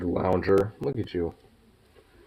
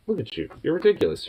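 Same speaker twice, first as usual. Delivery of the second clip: ordinary talk, nothing marked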